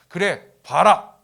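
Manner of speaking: loud, slow, emphatic order; forceful command. Retold or imitated. Jesus granting the blind man sight, forceful command.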